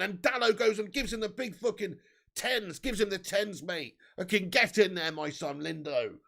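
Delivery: ordinary delivery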